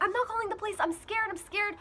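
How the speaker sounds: high, whiny imitation of a frightened brother; scared. Brother refusing to call the police, high and scared. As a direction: mimic a kid too scared to act.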